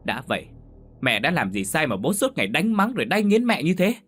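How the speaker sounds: put-on furious daughter confronting her drunk father; angry outburst. A narrator's reading. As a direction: let years of resentment burst out. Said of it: "rồi nói lớn".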